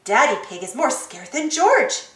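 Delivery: ordinary delivery, nothing marked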